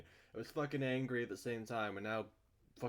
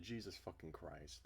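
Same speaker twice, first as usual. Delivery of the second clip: ordinary talk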